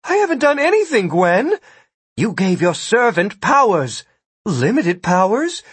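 galled. A narrator's reading. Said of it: "Ida seemed galled by the unfairness".

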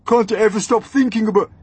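high, loud, rapid rebuke; exasperated scolding. A narrator's reading. Earthworm scolds the vain centipede, loud exasperation. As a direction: scold fast, breaking off mid-sentence.

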